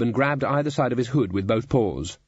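ordinary delivery, nothing marked

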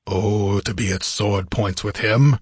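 a touch of a warrior's rumble; rumbled. A narrator's reading. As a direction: rumble it with restrained longing to fight. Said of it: "he rumbled".

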